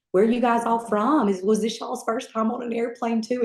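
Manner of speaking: high, bright small-talk questions; chatty questions. Retold or imitated. Chatty recruit asking others.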